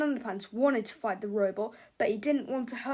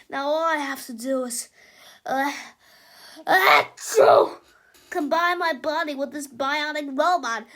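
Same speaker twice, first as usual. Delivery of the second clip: higher, louder grand boast with sneezes; boastful sneezing Melvin; 5 semitones higher, 10 dB louder than usual. Acted. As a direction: brag about your power between sneezes.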